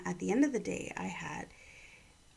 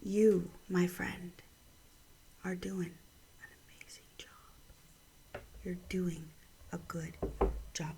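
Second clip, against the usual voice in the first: tender encouragement; 5 dB quieter than usual. Real, not acted. Speaker tells a friend they are doing well, tenderly. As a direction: let it sink almost to a whisper, word by word.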